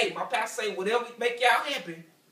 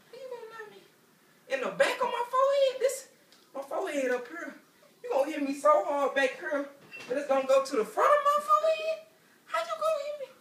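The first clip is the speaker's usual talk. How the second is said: much higher, squeaky disbelief; mock outrage squeaked high; incredulous squeal; 14 semitones higher than usual. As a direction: pitch way up like a kid who can't believe it. Incredulous high-pitched protest.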